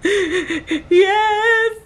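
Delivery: high, tearful imitated wail; hammed-up squeaky sob; mock crying. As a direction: act out bawling the answer. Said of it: "So I'm crying".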